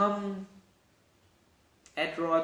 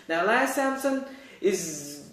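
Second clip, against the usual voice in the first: usual speaking voice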